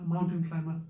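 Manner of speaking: normal talking voice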